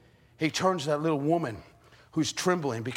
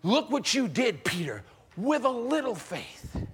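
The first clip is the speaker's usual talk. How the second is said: proud Jesus; 6 semitones higher, slower than usual. Acted. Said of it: "maybe change it just a little?".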